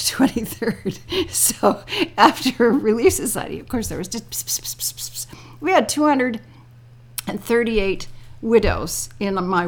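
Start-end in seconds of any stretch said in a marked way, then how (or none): 4.4-5.3 unvoiced hissing pss pss; gossip whispers. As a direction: mimic a roomful of whispering. Mimicking church gossip whispers.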